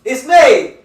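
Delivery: loud, high exasperated yelling; angry shout. Real, not acted. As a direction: shout them down, fed up and laughing. Yelling at boxing negotiators.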